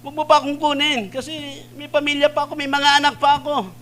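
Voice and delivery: high panicked shout for help; desperate shouting plea. Retold or imitated. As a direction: scream for your life while sliding down. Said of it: "sigaw na sigaw".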